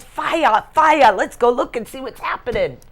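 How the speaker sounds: put-on high, loud eager child voice; excited little kid. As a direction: call out like a thrilled little boy. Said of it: "He was very excited".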